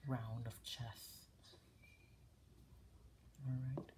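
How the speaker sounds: hushed, unhurried ASMR talk. Real, not acted. Host guiding viewers through a chess setup, hushed ASMR voice. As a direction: talk a viewer gently through it, half-whispered.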